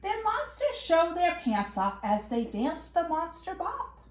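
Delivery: ordinary delivery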